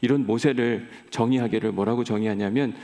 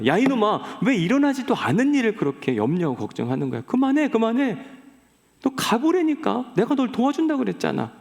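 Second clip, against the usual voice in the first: exasperated God; 10 semitones higher than usual. Acted. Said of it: "모세를 매우 답답해 하십니다".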